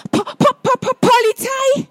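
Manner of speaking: high, stammering caretaker; breathless stutter, pitched high; excited stutter. Acted. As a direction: stumble over the first syllable in a panic. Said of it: "stottert der Hausmeister aufgeregt".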